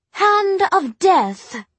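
put-on high, loud, drawn-out girl's voice; proud. A narrator's reading. Jessamine proudly supplying the French translation, proud. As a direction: show off proudly, slow and high. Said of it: "who was proud of her French".

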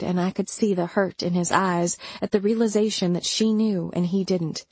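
ordinary delivery

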